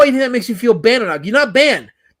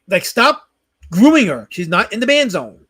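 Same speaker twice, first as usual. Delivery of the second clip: normal talking voice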